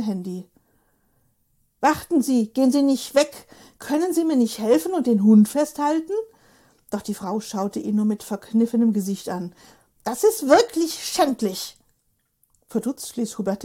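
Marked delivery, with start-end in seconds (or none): 1.8-6.1 louder, higher pleading call; man wrestling a dog calls to a passer-by; calling after her. Acted. Hubert calls after the leaving lady. 10.0-11.8 put-on high, slow, scornful old woman; old lady condemning him with disgust. Old lady's verdict, disgusted.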